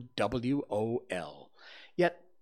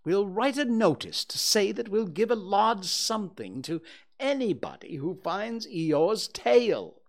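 raised-voice owl setting out the plan; Owl, emphatic; 8 semitones higher, 3 dB louder than usual. Acted. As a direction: explain insistently as a self-important owl.

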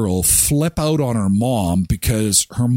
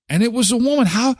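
ordinary delivery

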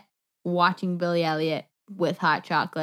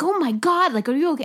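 alarmed friend; 10 semitones higher, 6 dB louder, faster than usual. Retold or imitated. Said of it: "And Kaylee's like".

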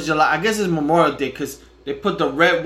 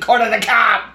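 mock Boston accent, a bit higher; 5 semitones higher, 4 dB louder than usual. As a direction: say it like a Bostonian teasing. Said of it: "He's gonna do with a Boston accent".

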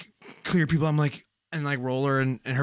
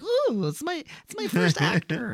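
put-on excited old lady; 8 semitones higher, slower than usual. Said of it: "she's like".

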